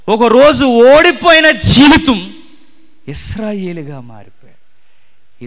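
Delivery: loud, high and slow proclamation; slow fervent proclamation. Acted. Preacher to congregation, slow loud proclamation. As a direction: proclaim it, stretching each word. Climactic sermon line.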